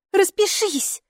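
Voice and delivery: put-on high childlike insistence; a girl pressing again; insistent girl. A narrator's reading. Hilla repeats to mom to sign, high child voice. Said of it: "повторила Хилла".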